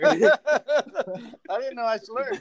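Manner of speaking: laughing through the words. Real, not acted. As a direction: giggle while saying it. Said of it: "There you go again".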